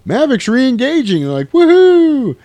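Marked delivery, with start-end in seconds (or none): 0.1-1.2 mock excited callout. Crew calling out the news. 1.5-2.4 high, loud whoop; mock cheer. Crew cheering Maverick's return, high loud whoop.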